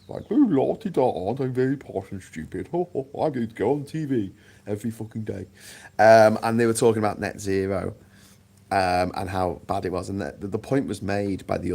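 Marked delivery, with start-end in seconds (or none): 0.3-5.5 sneering la-di-da posh voice; mock posh toff. Mocking a posh TV panel guest, in a sneering put-on toff voice. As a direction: lampoon a pompous private-school pundit. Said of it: "one of these private educated".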